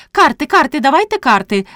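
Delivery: high, eager call for attention; excited cry. A narrator's reading. Priest's wife bursts into the parlour demanding cards, crying out. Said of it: "закричала Попаддя".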